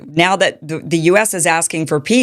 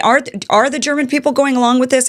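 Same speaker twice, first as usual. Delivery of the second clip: higher and faster, asking in genuine disbelief; surprised; 8 semitones higher, faster than usual. Real, not acted. Host asks guest about German people, surprised. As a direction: let real surprise lift the question. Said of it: "I'm just so surprised".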